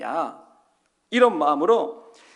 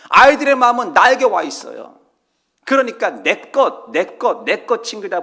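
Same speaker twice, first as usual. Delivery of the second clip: fervent preaching; 5 semitones higher, 9 dB louder than usual. Acted. Preaching at rising force.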